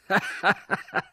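high scornful laugh; shrill jeering cackle; mocking laugh. A narrator's reading. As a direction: laugh down at him in contempt. Crucified man mocking.

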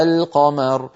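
القمر slowly, the L held before the qaf; clear lam. Shown as a demonstration. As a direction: say القمر as "al-qamar" with a lingering L. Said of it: "يظهرون اللام عند القاف".

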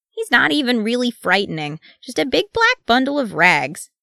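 put-on high, loud childish bravado; high-voiced scoffing bravado; boastful little one. A narrator's reading. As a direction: dismiss the foe like a cocky kid. Little dwarf boasts about the messenger.

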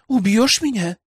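put-on high girl's voice, daring him; interrupting challenge. A narrator's reading. Cornered girl challenges elder's threat to her life, challenging. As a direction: throw the question back, pitched well up. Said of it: "перебила она".

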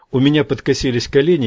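plain reading voice

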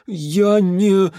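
high, frightened protest; frightened babbling. A narrator's reading. Peasant facing the mage's fury, frightened babbling. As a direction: start a high, pleading excuse. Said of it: "залепетал тот едва слышно".

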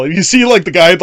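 normal talking voice

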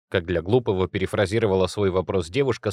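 ordinary delivery

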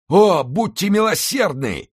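drowning man crying out, loud and high; desperate cry. A narrator's reading. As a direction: scream for mercy from the water. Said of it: "кричал молодой человек".

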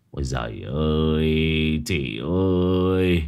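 drawn-out, slow lament; a touch of relieved wailing; lightly wailing brother. A narrator's reading. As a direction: stretch each cry out long and low. Overjoyed brother runs out wailing.

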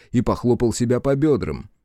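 ordinary delivery, nothing marked

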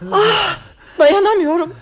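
high-pitched, overwrought dismay; theatrical distress. Acted. Wife who cannot bear talk of a will, overwrought. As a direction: protest as if the very word makes you tremble. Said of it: "tepeden tırnağa zangır zangır titriyorum".

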